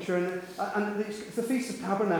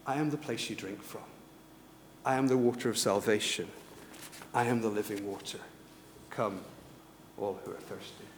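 low, soft and solemn, with pauses; hushed declaration; 7 semitones lower, 9 dB quieter than usual. Retold or imitated. Jesus standing up to answer the crowds, hushed declaration. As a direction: bring it right down, phrase by phrase.

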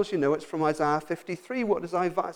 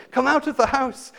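raised and higher, pleading to come out; mock desperate scream; 9 semitones higher, 5 dB louder than usual. Couple outside the shaking house calling people out, mock scream. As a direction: call urgently to the people inside. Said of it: "screaming and screaming".

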